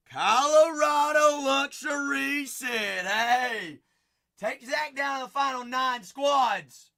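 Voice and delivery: big, high, loud announcement; hollered call-out. Real, not acted. Host calling a buyer's order, hollering. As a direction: belt it out to the whole stream.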